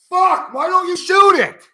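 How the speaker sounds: high, loud, strained yell at the TV; exasperated yell. Real, not acted. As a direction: scream the question at the players. Yelling at players on TV.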